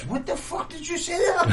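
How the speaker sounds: mock horror at your own words; shocked at yourself. Hearing what came out of your mouth, high laughing shock.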